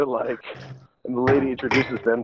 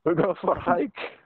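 put-on German accent; 6 semitones higher, slower than usual. Broken-English German accent.

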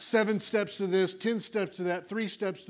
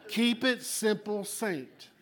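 slower, with a laugh in the voice; teasing restatement; 3 semitones higher, much slower than usual. Shown as a demonstration. Preacher teasing the saints he won't call stupid, amused and slow. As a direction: say it again, amused and drawn out. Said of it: "I'm not gonna call you stupid".

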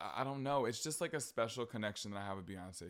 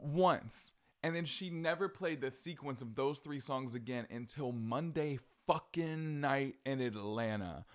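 usual speaking voice

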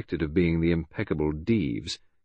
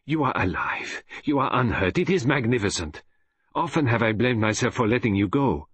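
put-on relieved, higher Belgian-accented outpouring; Poirot; 4 semitones higher than usual. Detective embraces his rescued friend, higher and relieved. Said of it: "he embraced me with vigour".

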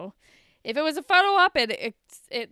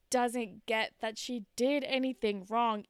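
ordinary talk, nothing marked

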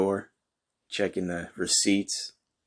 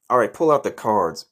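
usual speaking voice

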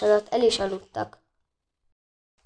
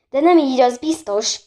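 loud, higher outcry of dismay; shocked shout; 4 semitones higher, 5 dB louder than usual. A narrator's reading. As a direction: shout it out, appalled. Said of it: "kiáltotta fel Bori döbbenten". (döbbent felkiáltás)

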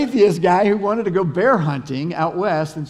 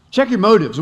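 louder, higher, driving the point home; emphatic repeat; 4 semitones higher, 7 dB louder than usual. Shown as a demonstration. Pastor to congregation, repeating the point with loud emphasis. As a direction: hammer the phrase a second time. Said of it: "Number one is check your motives".

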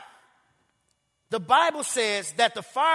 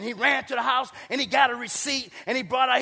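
ordinary delivery

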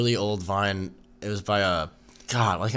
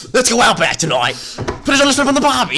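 high, loud manic Australian imitation; hammed-up frantic yelling; freaking out; 13 semitones higher, 7 dB louder than usual. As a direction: yell it like an Aussie losing it. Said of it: "he's, like, freaking out".

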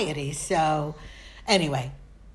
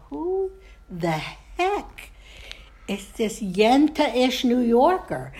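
high, drawn-out mock dismay; mock disbelief; 5 semitones higher than usual. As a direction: exclaim it in comic disbelief, stretching the words. Mocks own voice heard on podcasts.

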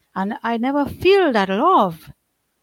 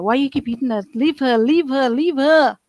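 rising, louder pleading cry; friend screaming; 5 semitones higher, 6 dB louder than usual. Retold or imitated. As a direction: yell at the mother to stop hitting. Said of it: "she would scream at my mother".

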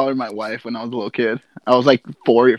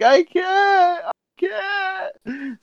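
retold crying; much slower than usual. Kid who can't take pills pleads with dad, mock crying. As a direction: whine it like a tearful little kid. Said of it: "I'm crying".

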